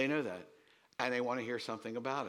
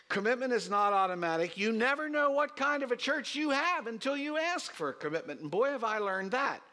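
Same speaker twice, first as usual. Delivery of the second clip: fervent sermon climb, higher and louder; preaching at full force; 10 semitones higher, 5 dB louder than usual. Acted. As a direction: drive the point home from the pulpit.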